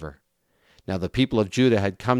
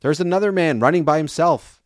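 mildly raised, higher-pitched call; watchman's call pitched up to carry; 7 semitones higher, 4 dB louder than usual. A narrator's reading. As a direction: call the news out to the gatekeeper, voice lifted. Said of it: "The watchman called out to the gatekeeper".

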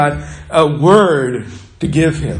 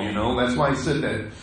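usual speaking voice

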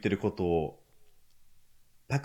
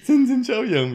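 mock shock; 12 semitones higher, 6 dB louder than usual. Retold shock.

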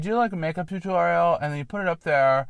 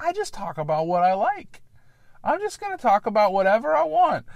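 mock rambling podcaster; 8 semitones higher than usual. Mocking lazy podcasters to his listeners, with a high whiny parody. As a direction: pitch climbing high into a whiny parody of a self-absorbed host.

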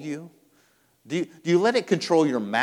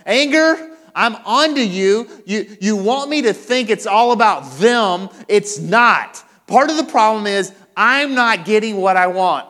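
loud, raised-pitch challenge; confronting anger; 6 semitones higher, 9 dB louder than usual. Acted. Preacher calling out anger as it rises, forceful confrontation. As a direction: call it out forcefully, as if facing an opponent.

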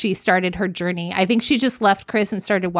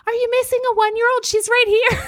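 very high, quick singsong helper voice; sweet lady; 14 semitones higher, faster than usual. Retold or imitated. Stranger returning a lost toddler, sweet lady voice. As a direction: put on a sweet little high voice. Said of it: "a sweet lady's like".

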